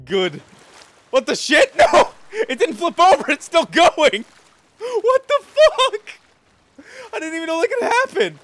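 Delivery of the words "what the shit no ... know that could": loud high disbelief; yelling in shock, laughing; excited shouting. Real, not acted. Ship flips, shouting in shock.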